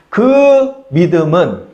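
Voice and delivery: "그" drawn out long before "믿음은"; emphatic stress. Real, not acted. Preacher sets the demons' faith apart, long stress on "그". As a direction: stretch "그" slowly to single it out.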